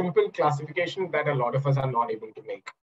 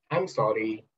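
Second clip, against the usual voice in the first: low, subdued apology; apologetic; 6 semitones lower, 3 dB quieter than usual. Shown as a demonstration. Teacher showing a listener one way to say sorry, apologetic. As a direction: apologise meekly in a lowered voice. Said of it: "He sounded apologetic".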